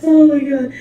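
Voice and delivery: high, loud exclamation; amused disbelief. Real, not acted. Shocked amusement at the interview answer.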